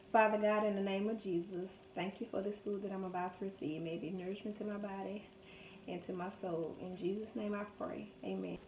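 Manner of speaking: soft, quick prayer over the food; quiet prayer. Real, not acted. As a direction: murmur a grace quickly and softly. Blesses the food before eating.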